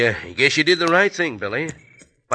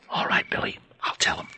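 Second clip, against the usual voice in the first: hushed whisper; 8 dB quieter than usual. Acted. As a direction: whisper so no one hears. Sneaking near jail at night.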